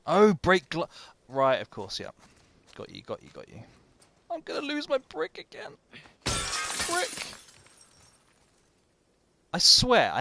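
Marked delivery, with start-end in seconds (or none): 2.8-3.6 hushed. Real, not acted. Sneaking up on enemy. 4.3-7.3 much higher, squeaky mock-panicked voice. Panicking over losing the brick.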